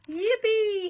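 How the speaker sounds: high, loud whoop of Yippee!; shouted. A narrator's reading. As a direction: belt out Yippee! with wild joy. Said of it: "he shouted".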